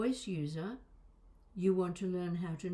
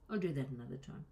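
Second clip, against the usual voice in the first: usual speaking voice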